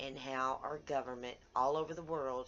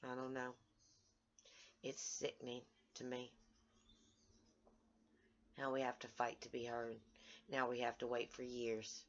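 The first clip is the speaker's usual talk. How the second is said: much softer and lower, worn out; sad, defeated; 4 semitones lower, 5 dB quieter than usual. Real, not acted. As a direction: barely get the words out. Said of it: "It's a heartbreaking day".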